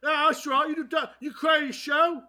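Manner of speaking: high hammed-up British teen; mock British kid actress. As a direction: taunt the show creators in a bratty accent. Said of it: "I'm Millie Bobby Brown".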